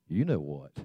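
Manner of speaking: very low, soft deadpan punchline; knowing parrot punchline. Acted. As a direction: drop way down and quiet, as the joke's parrot.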